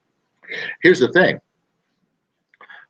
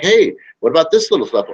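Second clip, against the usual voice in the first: pitching reminder; 8 semitones higher, 4 dB louder than usual. Retold or imitated. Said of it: "you're like".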